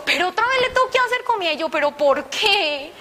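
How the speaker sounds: high-pitched, loud whiny complaint; exasperated protest. Retold or imitated. Daughter protesting to God about cooking for father again, exasperated protest. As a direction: wail it, stretching the final "por qué".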